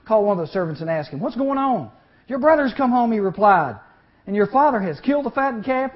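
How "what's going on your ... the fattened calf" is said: 2 raised, lifted voice for the servant's news; 3 call the news out high and loud; 4 excited storytelling of the servant's reply; 1 louder, higher retold dialogue. Servant scene in parable retold.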